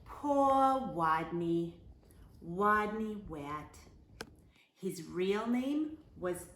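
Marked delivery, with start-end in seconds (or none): none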